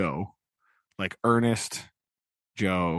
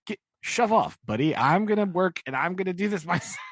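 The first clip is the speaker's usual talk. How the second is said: mock defiant telling-off; 10 semitones higher than usual. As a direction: shove him off like a spunky heroine. Biddy telling Pip off.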